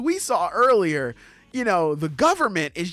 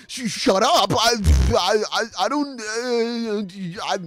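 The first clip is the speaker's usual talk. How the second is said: flustered, stammering denial; acted fluster, words failing him; 3 semitones higher than usual. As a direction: stumble over broken starts, trying to shut them up. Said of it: "he's all flustered and scared".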